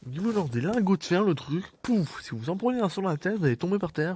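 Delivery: high, animated explaining; excited. Real, not acted. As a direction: get worked up about it. Excited gaming commentary.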